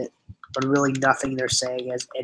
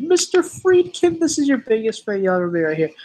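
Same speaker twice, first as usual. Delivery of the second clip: mock fan intro; 8 semitones higher, 3 dB louder than usual. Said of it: "right after Hayden said".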